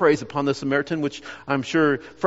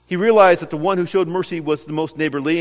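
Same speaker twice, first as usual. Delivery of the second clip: ordinary talk, nothing marked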